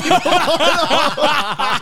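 high laugh breaking out; can't hold the laugh in; burst of laughter. Real, not acted. Friends recalling a hotel ghost scare, laughing hard. Laughing at old lady story.

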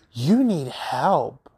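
slow, drawn-out imitation of a patronizing friend; mock-imitating Rinna telling Kathy she needs help; mocking condescension. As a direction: mimic her preachy tone with exaggerated slowness.

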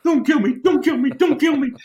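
very high, squeaky terrified plea; panicked pleading. Retold or imitated. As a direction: beg for your life, hammed-up cowering. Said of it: "hiding, going".

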